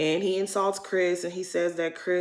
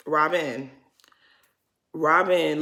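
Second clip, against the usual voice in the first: ordinary delivery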